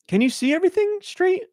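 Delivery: high-pitched careful question; tentative checking. Retold or imitated. Daughter checking dad's headset view, tentative and high. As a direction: ask dad gently, voice lifted high.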